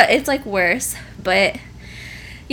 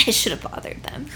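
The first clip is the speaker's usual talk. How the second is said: whispered aside; 4 semitones lower, 11 dB quieter, much faster than usual. Real, not acted. Rueful aside after laughing.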